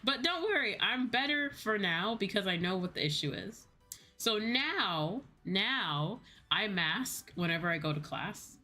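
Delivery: normal talking voice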